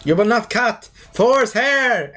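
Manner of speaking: slow, high, drawn-out heroic proclamation; mock-imperious warrior, words held long; Thor. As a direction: declaim it grandly, stretching the last word. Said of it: "And Thor was like".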